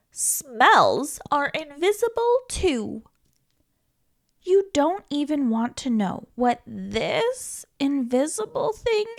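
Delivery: usual speaking voice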